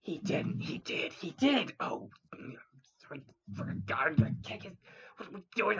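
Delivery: hushed frantic fairy whispering; panicked whisper. Acted.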